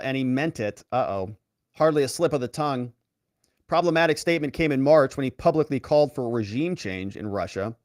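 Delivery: usual speaking voice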